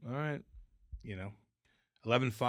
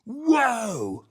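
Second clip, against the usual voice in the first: stunned whoa; 9 semitones higher, 7 dB louder than usual. Retold or imitated. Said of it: "I was like".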